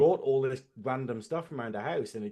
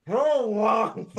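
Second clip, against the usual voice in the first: loud, high, muffled by a phone; Ferengi voice; 8 semitones higher, 7 dB louder than usual. Shown as a demonstration. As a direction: shout it round a mouthful. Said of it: "I've got my phone".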